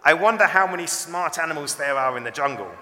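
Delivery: loud, high, cocky show-off; boastful monkey. A narrator's reading. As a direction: crow it out proudly.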